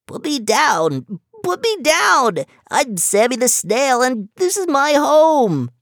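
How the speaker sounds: high, loud, slow little snail squeal; frantic high snail cries to be put down. Acted. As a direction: squeal in panic as a tiny snail. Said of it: "started squealing".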